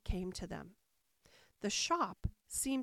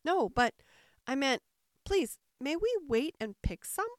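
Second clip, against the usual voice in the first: put-on raised, halting little-girl plea; earnest high-voiced request; pleading; 5 semitones higher, 5 dB louder than usual. A narrator's reading. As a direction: beg politely, stumbling at the start. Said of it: "Alice pleaded".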